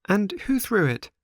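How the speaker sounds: high, pleading marionette voice; anxious puppet boy. A narrator's reading. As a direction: ask the old man with worried hope. Pinocchio voiced in story.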